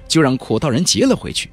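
ordinary delivery

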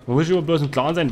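ordinary delivery

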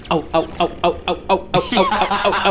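hopping ouches, pitch climbing; walking on hot sand. Retold or imitated. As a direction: yelp ow ow over scorching sand. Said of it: "Das war ganz schlimm auf Gran Canaria".

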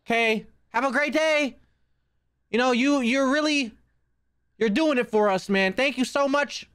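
high, sugary-sweet put-on voice; over-nice placating. Placating a scary game figure.

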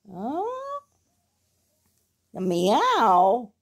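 usual speaking voice

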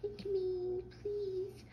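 teasing his girlfriend with a high, slow mock plea. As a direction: mimic her begging in a squeaky voice. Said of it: "I walked in on you saying it".

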